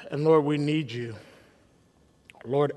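normal talking voice

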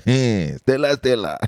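put-on calling out in an Igbo accent. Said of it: "That is the Igbo accent".

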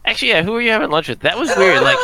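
fast, high, teasing question; excited. Real, not acted. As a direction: pounce on the gossip.

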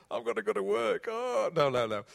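hammed-up loud complaint, starting high and sinking; mock wailing. Preacher joking as staff who must go to work, mock wailing. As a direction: wail in pretend dread of work.

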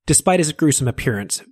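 ordinary delivery

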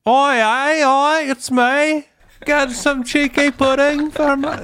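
high, slow, hammed-up Cockney, chimney-sweep style; a deliberately awful British impression; bad British accent. Shown as a demonstration. Showing friends her bad British accent. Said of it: "no, I'm not good at it".

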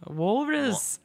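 put-on [name] accent. Co-host riffing on [name] songs, mock Liverpool accent. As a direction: toss out one word in a [name] accent. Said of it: "like, [name]".

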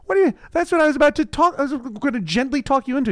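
rushed high fake-innocent voice, caught out; high, fast scheming mother.

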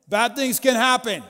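put-on father shouting a warning to kids; dad's warning. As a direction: call it up like an anxious father. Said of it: "I am my dad".

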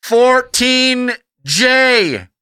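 slow, high stadium announcer; over a PA system. Pretend. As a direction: call it out over the loudspeaker. Stadium announcer calling fans.

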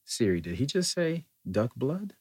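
can't believe what he just heard; incredulous question. Retold or imitated. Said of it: "and I go".

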